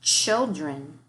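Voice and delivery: ordinary talk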